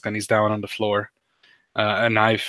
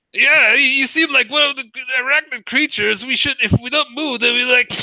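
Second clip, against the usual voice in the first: high, loud nervous patron voice; panicked bar patron; 12 semitones higher, 4 dB louder than usual. Acted. Cliff Clavin babbles at the bug before being eaten, high and loud.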